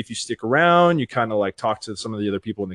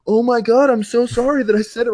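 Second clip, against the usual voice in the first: high, louder, over-the-top apologizing; gushing mock apology; 11 semitones higher, 4 dB louder than usual. Streamers fearing they offended him, imitated high gushing apology. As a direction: imitate someone horrified at their own slip.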